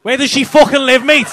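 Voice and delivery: very loud, high-pitched demand; demanding shout. Pretend. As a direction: yell the question as a mock interrogation. Demands again after no answer.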